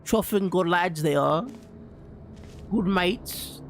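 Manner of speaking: lightly put-on British accent; drawn-out, hint of hammy British lad; mock British. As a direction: play at being a British chap. Playfully praising Brits in British slang.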